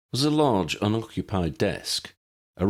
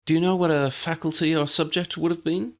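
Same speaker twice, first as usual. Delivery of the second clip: put-on high girl's voice, asking; Tally's voice; 10 semitones higher than usual. A narrator's reading. Tally asks Harry about the faculty, high and curious. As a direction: pitch it up into Tally's light question. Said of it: "asked Tally".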